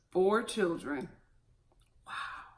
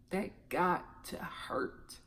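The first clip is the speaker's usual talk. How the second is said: whispered; 4 semitones lower, 13 dB quieter, much slower than usual. Real, not acted. Repeated softly to herself.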